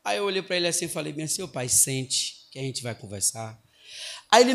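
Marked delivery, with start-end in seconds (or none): 1.4-3.6 low, soft invitation to sit; retold teacher keeping the voice down; calming request. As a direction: settle an angry parent, low and soft. Teacher settling an angry father.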